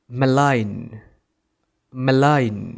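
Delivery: "malign" said muh-LAIN; silent G. Shown as a demonstration. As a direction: leave the g out of "malign". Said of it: "সেটার সঠিক উচ্চারণ হবে".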